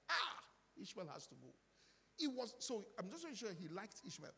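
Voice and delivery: usual speaking voice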